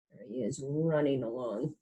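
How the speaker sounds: plain reading voice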